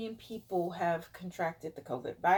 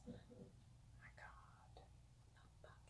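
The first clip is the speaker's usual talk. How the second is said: whispered disbelief; stunned hushed whisper; shocked whisper; 31 dB quieter, faster than usual. Real, not acted. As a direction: breathe it out in shock. Shocked at covid numbers.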